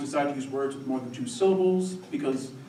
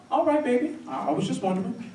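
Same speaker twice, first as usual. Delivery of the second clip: lightly voiced church lady, pitched up then dropping; church first lady; 8 semitones higher than usual. First lady backs off her question, high then easing down.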